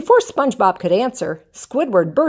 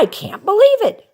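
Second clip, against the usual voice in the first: much higher, louder thrilled cry; excited cry; 13 semitones higher, 5 dB louder than usual. Acted. SpongeBob after signing the contract, thrilled cry. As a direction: burst with joy. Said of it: "SpongeBob cried".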